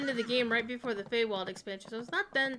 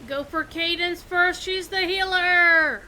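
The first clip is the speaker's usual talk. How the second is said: urgent callout; 7 semitones higher, 14 dB louder than usual. Real, not acted. Urgent boss fight callout.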